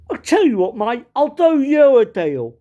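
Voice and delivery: loud, high Cockney wheeler-dealer. Retold or imitated. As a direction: pitch a deal in broad East End. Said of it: "And Cockney went".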